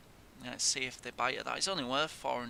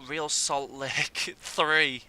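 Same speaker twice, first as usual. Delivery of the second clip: rueful laugh; 5 dB louder, much slower than usual. Real, not acted. Scoreline disbelief.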